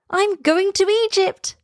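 very high, thrilled little swallow; high-pitched announcing cry; excited cry. A narrator's reading. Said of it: "cried the swallow".

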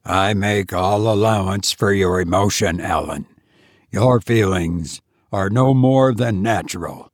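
put-on a tired old man, speaking slowly. A narrator's reading. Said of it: "he said slowly".

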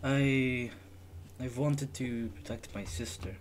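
quiet, slow, somber retelling; heavy-hearted traveler opening up; somber backstory. Acted. Character recalls hard past.

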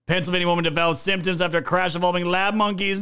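ordinary talk

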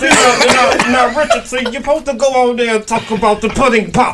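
high, loud lecturing put-on voice; bad Bill Cosby impression. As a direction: scold like a preachy old comedian. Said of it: "bad impression".

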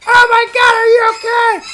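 high, panicked imitation of Julie; mock panicked girlfriend. Retelling Julie's panic at night, high mock fright. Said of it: "Julie ... freaking out".

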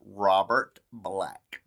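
slow, drawn-out dramatic intro; slow dramatic announcement. Acted. Host slowly announcing the case to her cohost, dramatic. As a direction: stretch each word like a true-crime host. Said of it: "dramatic slow story intro".

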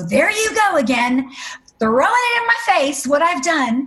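angry at God. Retold or imitated. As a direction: throw the accusation upward, exasperated. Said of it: "I'd get so angry".

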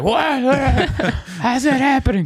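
high, laughing disbelief; a big lifter baffled, voice cracking high; shocked beefy guy. Retold or imitated. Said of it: "some big beefy turd of a guy is like".

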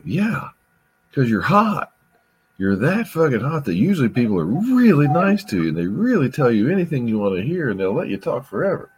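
high mocking sneer at her; sarcastic high-pitched aside; sarcastic inner voice. Sarcastic thought about her being hot.

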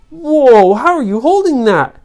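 high, loud whoa of amazement; amazed. Acted. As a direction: marvel at an impossible feat. Children amazed at huge gem.

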